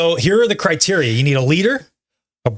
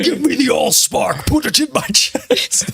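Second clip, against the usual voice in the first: mock Optimus line; 9 semitones higher, slower than usual. Transformers movie quote.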